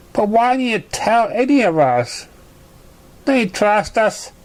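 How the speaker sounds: lightly voiced hurt filly; hurt friend. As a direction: ask why she hid it.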